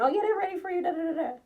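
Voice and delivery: high, sugary imitation of the host; fake-sweet mimic. Mocking the Airbnb host's fake sweetness to the listeners, high sugary voice.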